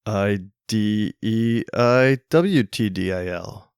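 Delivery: slow letter-by-letter spelling; spelled out slowly. Retold or imitated. Owner spells the dog's name to a confused stranger, slowly. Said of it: "you'll be like".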